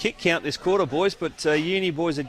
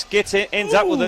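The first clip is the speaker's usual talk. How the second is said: excited; 10 semitones higher than usual. Real, not acted. Sudden play in commentary.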